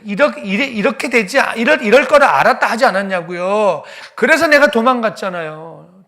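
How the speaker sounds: loud, high, heated protest; Jonah protesting to God. Acted. Jonah shouting his complaint at God, angry. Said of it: "이 절에 이렇게 대듭니다".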